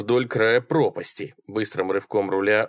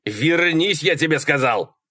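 higher, insistent shout; exasperated raised-pitch command; yelled order; 6 semitones higher than usual. A narrator's reading. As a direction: demand their return at a yell. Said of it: "снова заорал Малькольм".

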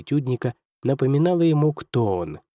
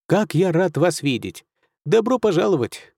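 delighted welcome; 5 semitones higher than usual. A narrator's reading. Doctor warmly greets guest.

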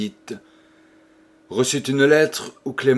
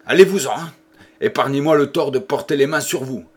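shouting; 3 semitones higher, 4 dB louder, faster than usual. Acted. Husband throwing the priest out, a furious shout. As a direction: drive the priest out, barely holding back blows. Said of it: "lui cria".

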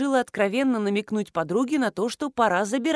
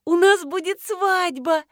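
high, loud, drawn-out weepy cry; tearful wail; 9 semitones higher, 5 dB louder, slower than usual. A narrator's reading. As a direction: wail it out, crying for joy. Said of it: "заплакала Нина". (плаксиво, нараспев)

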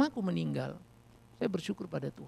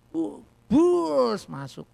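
stab sound; 6 semitones higher, 8 dB louder, much slower than usual. Retold or imitated. Knife stabbing into the brother.

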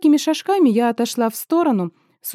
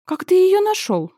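alarmed; 9 semitones higher than usual. Acted. Startled heroine, high pitch.

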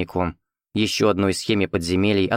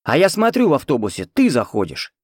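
eager, higher chatter; 5 semitones higher than usual. Acted. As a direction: rattle it off eagerly. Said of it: "продолжил тарахтеть Игорёк".

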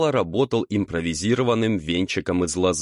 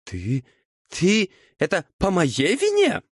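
incredulous, pitch leaping high; affronted devil's high-pitched questioning; indignant; 12 semitones higher than usual. A narrator's reading. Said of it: "возмутился чёрт".

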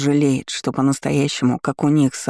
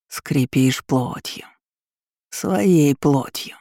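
demonic whisper; much slower than usual. A narrator's reading. Demon demands flesh.

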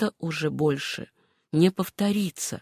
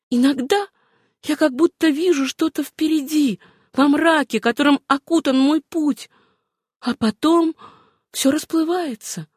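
high, wondering woman's voice; Suzanne's voice; 7 semitones higher than usual. Acted. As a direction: recount a dim vision, pitched high. Героиня описывает смутное видение. (высокий, задумчивый голос героини)

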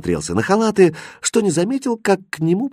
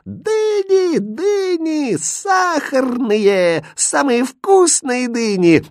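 high, drawn-out hawker's chant; singsong street-seller call; market vendor's cry; much slower than usual. A narrator's reading. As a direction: stretch each word to sell across the bazaar. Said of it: "от крика продавцов".

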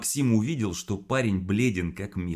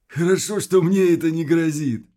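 creaky, higher and louder rasp; 11 semitones higher, 9 dB louder than usual. Acted. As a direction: scrape the words out creakily. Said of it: "проскрипел Квас".

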